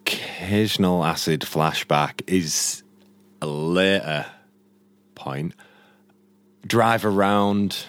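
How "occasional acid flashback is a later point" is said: lightly voiced laid-back Dude, low and slow; Dude impression. Friend doing a bad Dude impression in a quiz, low and lazy. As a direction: mumble it lazily like a stoner bowler. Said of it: "terrible at this fucking impression".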